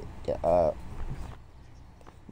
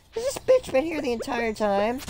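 raised, squeaky indignation; high mock outrage; 11 semitones higher than usual. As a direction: pretend to be shocked.

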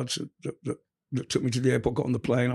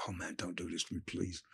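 much lower and much softer, begging; quiet pleading; 7 semitones lower, 18 dB quieter than usual. Retold or imitated. Freshly released young man begging the customs officer, hushed. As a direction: plead under your breath. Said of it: "I was just like".

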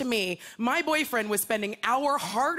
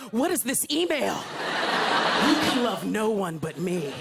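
cat voice; 2 semitones lower, slower than usual. Acted. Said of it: "they're like".

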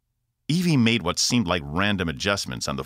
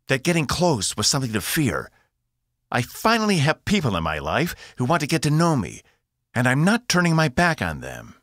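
higher, insistent protest; rising defiance; 9 semitones higher, 4 dB louder than usual. A narrator's reading. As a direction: push back hard, voice climbing. Evie defies her father.

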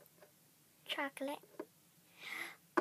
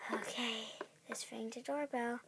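softer, unvoiced whisper; 8 semitones lower, 9 dB quieter than usual. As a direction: hush it as you sneak up. Sneaking up to door.

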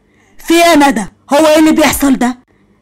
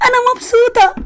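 tearful joy; 12 semitones higher than usual. Acted. Tearful happiness.

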